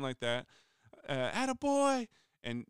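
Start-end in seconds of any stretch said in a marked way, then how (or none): 1.3-2.1 much higher, louder mock yell of praise; proud cheer. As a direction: cheer him on like a coach. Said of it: "I screamed really loud".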